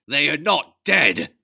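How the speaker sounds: very loud, high, slow denial; furious shout. Acted. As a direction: roar it at him. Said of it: "the captain shouted".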